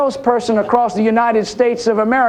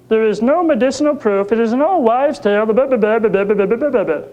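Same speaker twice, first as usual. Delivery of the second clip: lightly voiced lecturing doctor; imitating a know-it-all on television; mock TV expert. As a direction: parody an expert droning on into blah-blah.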